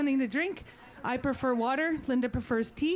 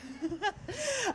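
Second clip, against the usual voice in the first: soft high laugh; light laugh; 8 semitones higher, 5 dB quieter than usual. Real, not acted. Drummer admits to audience that drumming takes it out of her, laughing. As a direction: laugh it off, quietly.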